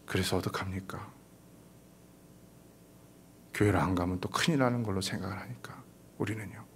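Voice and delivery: soft unvoiced confiding; hushed whisper. Real, not acted. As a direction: confide it quietly to the hearers. Hushed aside in anecdote. (조용히 속삭이며)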